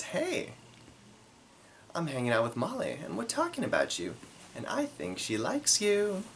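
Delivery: put-on high, breathless girlish read of the text; lightly voiced Brooke, high and hushed; Brooke's text voice. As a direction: read it like an excited friend spilling gossip.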